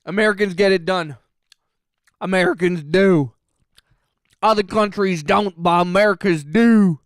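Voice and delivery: a hammed-up gung-ho American accent, leaning on the final do; American patriot voice. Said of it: "like a very American sounding person saying".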